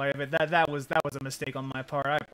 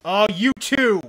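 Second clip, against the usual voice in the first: sarcastic angry shout; 6 semitones higher, 11 dB louder than usual. Real, not acted. Raging at game.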